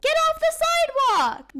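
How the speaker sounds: high, loud panicked order; alarmed yell. Acted. Parent to learner driving on the sidewalk, alarmed shout. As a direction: yell it to stop a disaster.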